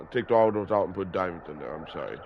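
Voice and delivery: usual speaking voice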